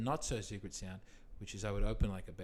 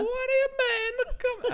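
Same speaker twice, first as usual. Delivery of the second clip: put-on much higher, louder Italian mamma accent; shrill-free, hammed-up Italian woman, Italian accent; Italian woman scolding, Italian accent; 11 dB louder, slower than usual. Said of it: "I do the woman and the man".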